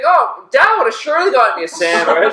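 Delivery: high, loud teasing call; mock heckling. Storyteller plays friends needling someone over a sandwich, high loud mock complaint.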